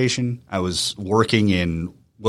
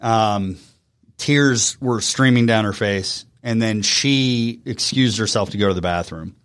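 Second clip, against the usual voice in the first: ordinary talk, nothing marked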